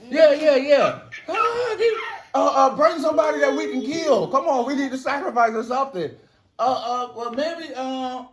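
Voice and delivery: high, hurried, frantic voice; flustered desperation. Acted. Ezra's team needing someone to sacrifice, frantic high babble. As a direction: scramble for excuses, high and fast.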